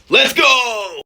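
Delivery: loud excited shout, Let's go! pitched high; rally the table; hyped shout. Real, not acted. Hyping the big charge.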